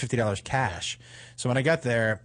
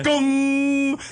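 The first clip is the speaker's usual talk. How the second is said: high, long ringing gong noise; imitated glass-door collision; 12 semitones higher, 4 dB louder than usual. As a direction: voice a head hitting glass. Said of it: "She was like".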